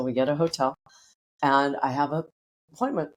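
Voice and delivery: ordinary delivery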